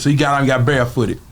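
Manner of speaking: normal talking voice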